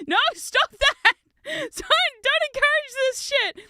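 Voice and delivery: high, loud, slow exasperated scold; loud high laughing objection; laughing protest. Retold or imitated. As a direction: scold while cracking up. Scolding sister-in-law, laughing.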